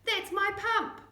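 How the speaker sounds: loud, high indignant shout; outraged yell about the pump. Acted. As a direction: shout it down the track. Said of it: "he yelled".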